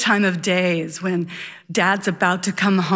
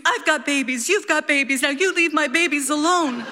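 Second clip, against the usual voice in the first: high, fierce mock scream at no great volume; contained retelling of a protective scream; retold mother's scream; 6 semitones higher than usual. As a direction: imitate a mother fighting off an attacker, pitched up. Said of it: "she punches it and screams".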